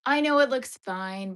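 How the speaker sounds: loud burst of self-reassurance; emphatic. Real, not acted. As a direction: blurt it out firmly.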